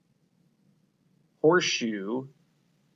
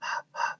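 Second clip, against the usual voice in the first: a soft voiceless h, only breath; whispered h sound; 5 dB quieter than usual. Shown as a demonstration. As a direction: breathe out the h sound for them to copy. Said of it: "Say hive".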